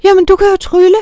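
high, loud amazed outburst; loud astonished boy. A narrator's reading. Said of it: "Anders gjorde store øjne".

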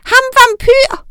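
put-on very high, loud, slow toddler voice; very high baby accusation; baby witch. A narrator's reading. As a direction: point and accuse like a tiny child.

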